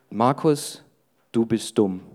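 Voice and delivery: low, put-on voice of a nagging inner thought; whispering thought. The preacher voices the thought mocking Markus, low and sly. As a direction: let the lie creep in low and close. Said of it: "der flüstert mir ständig zu".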